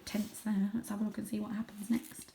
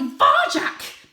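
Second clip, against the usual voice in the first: sharp, high, loud hissed name; hissed rebuke; 13 dB louder than usual. Acted. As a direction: scold him in a hiss. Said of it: "hissed Mother".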